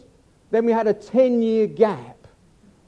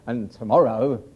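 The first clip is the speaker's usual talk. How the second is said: low, smug weatherman voice, dropped well down; mock weather presenter, lower and oily; smarmy Michael Fish; 11 semitones lower than usual. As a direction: send up a smooth TV forecaster. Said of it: "these smarmy sort of Michael Fish".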